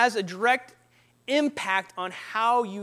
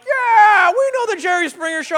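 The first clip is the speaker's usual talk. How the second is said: high, loud shout of recognition; imitated crowd roar, high-pitched; excited crowd cheer; 9 semitones higher, 8 dB louder than usual. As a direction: holler it like a thrilled audience. Imitates excited audience in Taiwan.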